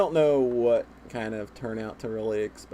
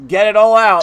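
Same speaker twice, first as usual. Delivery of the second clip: loud, raised-pitch urging; urging shout; 6 semitones higher, 11 dB louder than usual. Real, not acted. Host urging co-host to vent about Sonic, shouted. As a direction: shout it like a rallying call.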